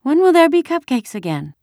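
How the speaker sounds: put-on very high, loud child's question; little girl. A narrator's reading. Kirsty asks mother about cupcakes, longingly. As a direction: ask plaintively in a small child's voice.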